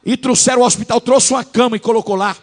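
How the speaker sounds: fervent pulpit shout; preaching loud. Real, not acted. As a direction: drive it home to the congregation. Preaching at full force.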